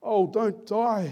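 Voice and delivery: high, drawn-out anguished appeal; slow, hammed-up pleading; melodramatic plea. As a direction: beg a dying friend, laid on thick. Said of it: "It's a bit melodramatic".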